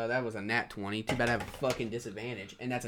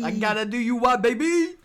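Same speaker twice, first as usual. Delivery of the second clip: hammed-up taunt; 10 semitones higher, 6 dB louder than usual. Player hams a taunt.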